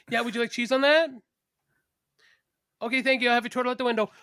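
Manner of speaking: mock drive-through attendant; fast-food server. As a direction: take the order in a put-on service voice. Sister serving a drive-through customer.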